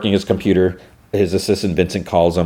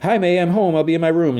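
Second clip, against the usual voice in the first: put-on casual teen; 12 semitones higher than usual. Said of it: "He's like".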